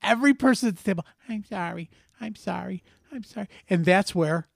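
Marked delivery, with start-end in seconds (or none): 1.3-3.5 very soft, meek repeated apology; small, quiet put-on child voice; mock tearful apology. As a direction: imitate a chastened little kid going person to person. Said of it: "She starts crying".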